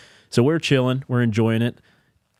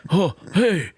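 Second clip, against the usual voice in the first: hammed-up folksy president voice; Joe Biden; 7 semitones higher, slower than usual. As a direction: mimic a folksy elderly president. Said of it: "Adam Ray was Joe Biden".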